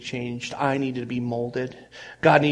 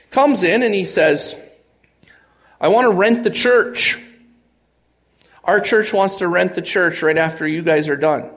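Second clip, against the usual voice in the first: loud, high, driving sermon voice; preaching at full force; 5 semitones higher, 6 dB louder than usual. Acted. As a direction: preach it out with rising fire. Retelling the miracle at full force.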